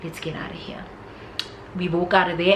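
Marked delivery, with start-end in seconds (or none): 0.0-0.8 hushed urging. Retold or imitated. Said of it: "And I was like".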